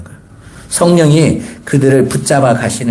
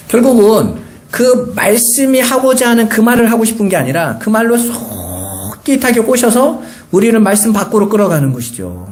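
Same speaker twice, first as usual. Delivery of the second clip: ordinary talk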